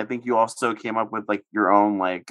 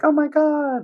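very high squeaky cute voice; sugary high girly squeal; cutesy voice; 4 dB louder, slower than usual. Retold or imitated. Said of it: "the really, like, cutesy voices".

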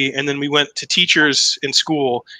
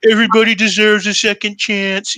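mocking politician impression, pitched up and far from own voice; Rosie doing Trump; 7 semitones higher, 4 dB louder than usual. Comic imitates Rosie mocking Trump on TV, exaggerated Trump impression. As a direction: imitate a host mimicking a pompous politician. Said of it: "it was a good Trump impression".

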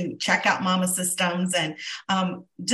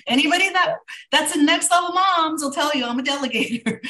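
higher, louder, through laughter; laughing admission; 8 semitones higher, 5 dB louder than usual. Real, not acted. Host admits to listeners she's a delegator, laughing. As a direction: laugh as you own up to it.

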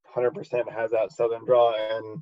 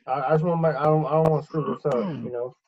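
higher, mumbled Southern voice; 3 semitones higher, faster than usual. Pretend. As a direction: lightly play a rambling country soldier. Continuing soldier voice.

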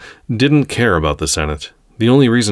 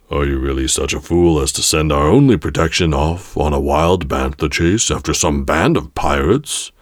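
put-on lower, measured old councillor voice; aged character voice, pitched down; elderly Togruta; 3 semitones lower than usual. A narrator's reading. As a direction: counter scornfully as an old Jedi. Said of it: "the elderly Togruta countered".

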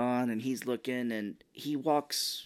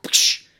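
loud, sharp slap sound effect; sudden explosive "psh"; imitated slap; 11 dB louder than usual. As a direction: make the crack of a slap with your mouth. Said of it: "slaps the shit out of her hand".

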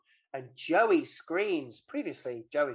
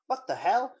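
mock shock; 7 semitones higher than usual. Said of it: "then he goes".

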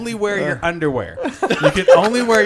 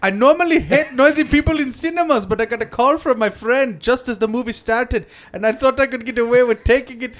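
higher-pitched, badly faked British accent; wobbly British accent sliding toward Indian and Scottish; put-on British accent; 5 semitones higher than usual. British moviegoer's quote read aloud, put-on British accent. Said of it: "This has gotta be a British, uh, guy".

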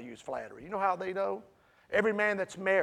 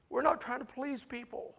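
heartfelt; 5 semitones higher than usual. Acted. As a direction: lay your heart out gently. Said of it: "Paul laying his heart out there".

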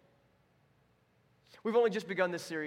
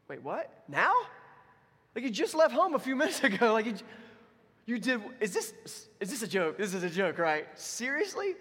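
high, loud disbelieving wait what; a dad who cannot believe the phone call; climbing to a high seriously in disbelief; incredulous dad; 7 semitones higher, 6 dB louder than usual. Retold or imitated.

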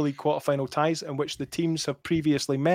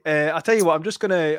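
usual speaking voice

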